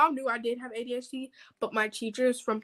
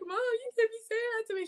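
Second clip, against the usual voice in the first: high, quick whiny protest; whining protest; 12 semitones higher, faster than usual. Retold or imitated. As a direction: whine back at mom, pitched high. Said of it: "I don't like that whining".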